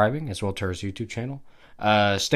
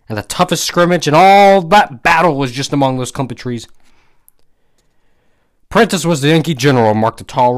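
emphatic, louder storyteller reading; story narration; 6 dB louder than usual. A narrator's reading. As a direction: read the old war tale out with relish. Reads a story aloud.

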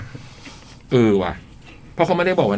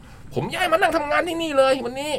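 higher, lightly softer teacher voice; imitating the teacher; 12 semitones higher, 5 dB quieter than usual. Host quotes teacher arriving early, high-pitched. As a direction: mimic the teacher explaining he moved in. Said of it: "เนี่ยเสียงเหมือนอีกแล้ว".

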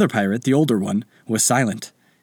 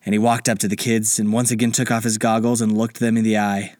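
ordinary delivery

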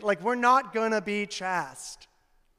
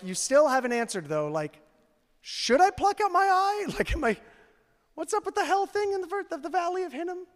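mock listener question; 9 semitones higher, faster than usual. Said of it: "some of you are like".

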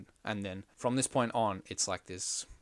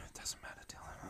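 sighing, whispered brush-off; a weary whisper to her co-host; exasperated whisper; 17 dB quieter, faster than usual. Real, not acted. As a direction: hiss it out, fed up with him. Annoyed reply to nitpicking co-host.

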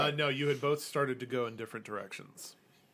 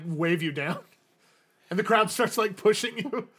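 ordinary delivery

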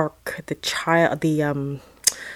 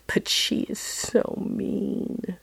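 put-on soft, higher, slow childlike plea; small hushed voice, stretching the words; meek protest; 4 semitones higher, 9 dB quieter, slower than usual. Child pleads meekly with the voice.

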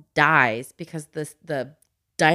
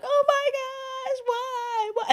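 much higher, drawn-out mock wail; imitating crying kids; much slower than usual. Mom imitating her kids crying at the orca scene, mock wail. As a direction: whimper it like an upset child. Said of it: "And they were in tears".

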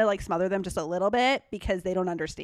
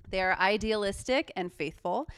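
ordinary talk, nothing marked

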